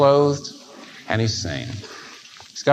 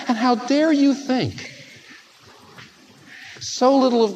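fervent preaching; 8 semitones higher than usual. Retold or imitated. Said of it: "He says".

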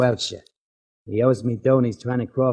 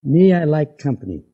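loud taunt; 6 semitones higher, 10 dB louder than usual. Acted. Gangster mocking, loud retort.

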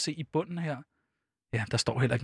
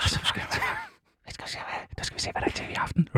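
hushed rush; 6 dB quieter, much faster than usual. Real, not acted. Quick aside over jingle.

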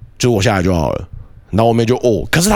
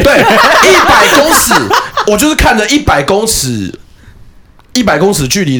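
laughing; 9 semitones higher, 4 dB louder than usual. Real, not acted. Laughing at forgetting sister.

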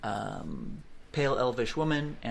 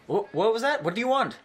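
startled demand; 10 semitones higher, 5 dB louder, faster than usual. Acted. Sailor woman confronts stranger on deck.

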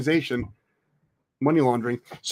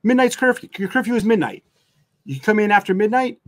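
normal talking voice

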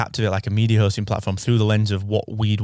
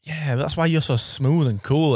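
ordinary delivery

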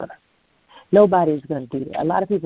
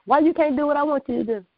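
high, fast mock scolding; 9 semitones higher, faster than usual. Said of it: "He said".

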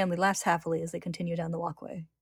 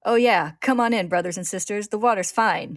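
loud, higher mad bravado; shouting; 3 semitones higher, 9 dB louder than usual. A narrator's reading. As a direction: shout a gleeful, taunting invitation. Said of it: "he shouts".

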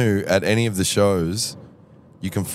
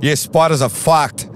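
higher, louder, slow drawn-out bloke; Michael's delivery; 8 semitones higher, 5 dB louder, slower than usual. Retold or imitated. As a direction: savour every word like Michael. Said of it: "But he didn't say it like that".

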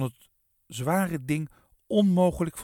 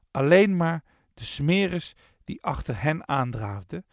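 plain reading voice

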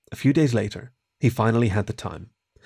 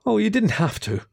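higher-pitched, sheepish murmur; ashamed murmur; 8 semitones higher than usual. A narrator's reading. Kieran to the weasel who brought sweets, ashamed murmur. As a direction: sound embarrassed by an undeserved gift. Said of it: "Kieran murmured, ashamed".

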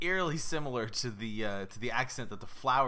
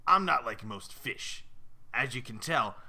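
big New York goldfish with a rasp; intimidating raspy New Yorker. Acted. Fish boasting to its owner, intimidating New York rasp. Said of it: "sir, that sounds oddly intimidating".

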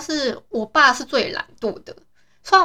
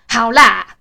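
"好啦" barked out loud and curt; "好啦" snapped back loudly, impatient; harsh okay; 8 dB louder than usual. Shown as a demonstration. How dad hears her reply, loud and rude. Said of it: "是说".

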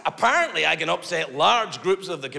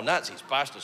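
normal talking voice

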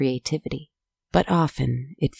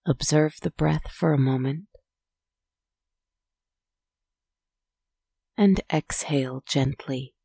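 soft spa whisper; 3 dB quieter than usual. Acted. Leads a breathing pause.

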